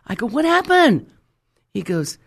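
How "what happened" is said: high, loud, bursting impatience; excited retold outburst; eager demand. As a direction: act out being desperate for the news.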